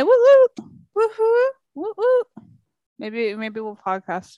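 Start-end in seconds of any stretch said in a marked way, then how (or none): 0.0-2.2 high, loud cheering woo woo; excited whoops. Real, not acted. Friend cheering to the host about visiting her, high and loud. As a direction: cheer like you just got great news.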